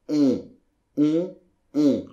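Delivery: "on" as a pure nasal vowel, no N sound; French nasal on. Shown as a demonstration. Teacher demonstrating the nasal "on" to the learner. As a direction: hum "on" up through the nose, mouth nearly closed. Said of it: "voilà comment ça doit faire".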